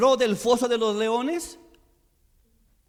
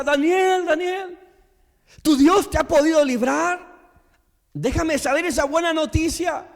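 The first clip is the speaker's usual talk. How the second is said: retold shout; 6 semitones higher than usual. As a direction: call his name across the lions' den. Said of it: "le grita".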